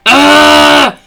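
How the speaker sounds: loud exasperated ugh; frustrated groan. Real, not acted. Angry at a viewer's parents, a loud frustrated ugh. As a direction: let out a loud fed-up groan. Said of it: "Sorry, I'm not angry at you".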